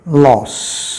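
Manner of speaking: लॉस said with a short open "aw" and an s; loss with short o. Shown as a demonstration. Teacher to students showing the word loss, short open vowel. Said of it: "अगर phonetically इसको मैं transcribe करूँ".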